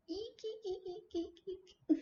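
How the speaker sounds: tiny high whispered eeks; squeaky toy voice. Pretend. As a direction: squeak like a little rubber toy. Squeaking while placing pumpkins.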